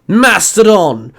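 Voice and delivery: slow, loud, high battle call. Acted. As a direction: shout the morph call. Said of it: "Dante called out".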